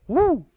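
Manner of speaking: loud, high startled owl hoot. Retold or imitated. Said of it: "And the owl goes".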